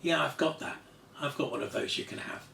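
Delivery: matter-of-fact understatement, falling away; deadpan. Retold or imitated. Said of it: "in a rather deadpan way said".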